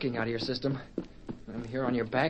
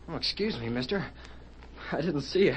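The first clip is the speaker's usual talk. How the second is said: usual speaking voice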